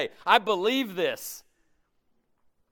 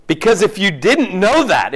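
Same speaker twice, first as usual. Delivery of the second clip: loud; 11 dB louder than usual. Real, not acted. Preacher's emphatic outburst.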